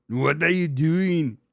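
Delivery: loud, drawn-out action-hero impression; Arnold Schwarzenegger. Dad impression morphed into hammed-up strongman voice. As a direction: boom it out slowly, hammed-up Austrian strongman. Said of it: "it turned into Arnold Schwarzenegger".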